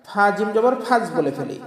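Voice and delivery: normal talking voice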